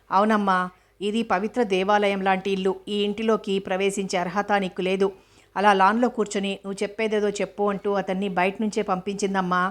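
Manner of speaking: ordinary delivery, nothing marked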